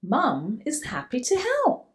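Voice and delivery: ordinary delivery, nothing marked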